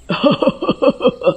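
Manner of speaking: high eerie gloating cackle; witch cackle. A narrator's reading. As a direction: cackle with greed. Witch laughs at night. (चुड़ैल की हँसी)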